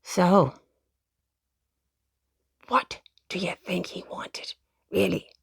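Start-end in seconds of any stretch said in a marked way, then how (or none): 2.7-5.3 put-on whispered aside to the children; a secret little whisper. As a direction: lean in and whisper the question. Teasing question to child listeners.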